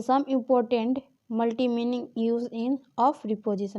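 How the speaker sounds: drawn out, word by word; slow dictation. Shown as a demonstration. Teacher dictating a heading to the class, slow. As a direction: dictate it so they can write it down.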